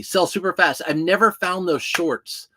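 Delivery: usual speaking voice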